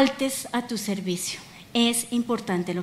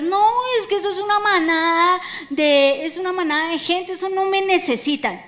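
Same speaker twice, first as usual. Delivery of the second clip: lightly acted protest, pitched higher; mock excuse; 6 semitones higher than usual. Preacher mimicking the devil's lies to her church, mock protest. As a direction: voice a self-doubting excuse, drawn up high. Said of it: "el diablo ... te ha dicho mentiras".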